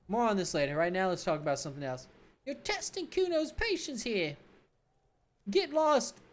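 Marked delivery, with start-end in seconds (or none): 2.5-6.2 high, slow, sneering brat voice; bratty kid Kuno. Acted. Cocky kid mocking the cop, in a high bratty voice.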